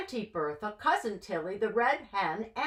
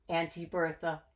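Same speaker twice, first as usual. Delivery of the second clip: ordinary delivery, nothing marked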